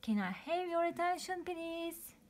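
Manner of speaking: high, fast call to the crowd; rally everyone quickly; eager announcement. Acted. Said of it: "shouted Super Tito".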